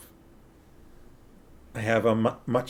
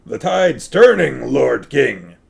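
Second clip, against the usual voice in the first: captain voice; 2 semitones higher, 6 dB louder, slower than usual. A narrator's reading. Sea captain warns the king the tide is turning, louder. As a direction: bellow it like a ship's captain. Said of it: "Ben didn't like my captain voice".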